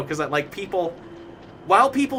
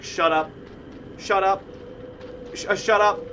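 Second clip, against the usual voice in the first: put-on higher blurting kid voice; special kid voice; 5 semitones higher than usual. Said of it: "He'd be like".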